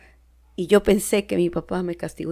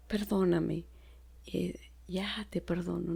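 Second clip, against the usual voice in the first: whispered apology to mother; whispered forgiveness to mom; soft apology; 12 dB quieter than usual. Retold or imitated. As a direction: soften it almost to a whisper.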